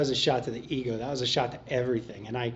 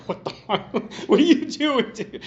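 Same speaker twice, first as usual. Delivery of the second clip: breathy then much higher laughing outburst; laughing disbelief; 11 semitones higher, 6 dB louder than usual. Retold or imitated. Newcomer reacting to Tom at his car trunk, laughing. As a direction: crack up in disbelief. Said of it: "you're like".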